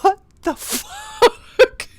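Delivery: very high, loud, slow laughing disbelief; incredulous. Retold or imitated. Man stares back at screaming schoolkids, loud laughing disbelief. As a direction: squeal it out half-laughing in shock. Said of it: "I'm just staring at them like".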